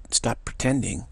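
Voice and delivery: usual speaking voice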